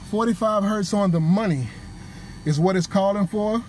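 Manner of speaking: ordinary delivery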